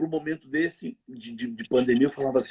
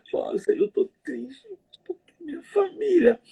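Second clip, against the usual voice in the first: high, slow, whining mock lament; hammed-up self-pity; whiny complainer; slower than usual. Mimicking a believer who whines to God, whiny.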